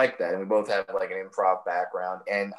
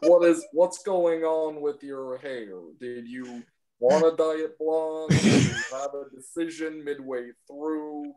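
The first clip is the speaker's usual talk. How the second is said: imitating Barry Katz; 2 semitones higher than usual. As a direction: lightly play a manager grilling the hosts. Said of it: "And he goes".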